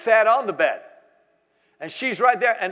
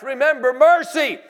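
fervent plea; 6 semitones higher, 6 dB louder than usual. Acted. Preacher reading Habakkuk's prayer.

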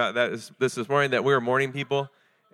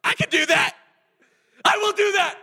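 building to a much higher, louder heroic cry; pumped-up hero; 4 semitones higher, 6 dB louder than usual. Retold or imitated. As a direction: rise like a superhero ready to fight. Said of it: "You're like".

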